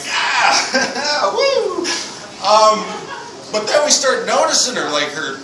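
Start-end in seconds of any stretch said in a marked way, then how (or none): 0.1-1.8 laughing woo, pitched way up; excited whoop. Retold or imitated. Cheering at the bull rider, a high laughing whoop.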